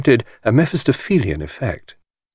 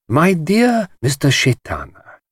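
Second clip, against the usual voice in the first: lightly voiced suave host, drawn out; Chaitana voice; 7 semitones higher, slower than usual. A narrator's reading. Chaitana greets an old rival, lightly voiced and smooth. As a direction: purr it like a sly society host. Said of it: "he said".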